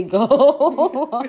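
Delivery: loud, slow question dissolving into laughter; drawn-out words breaking into giggles; cracking up. Real, not acted. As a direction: lose it laughing as you finish. Cracks up reading silly thought.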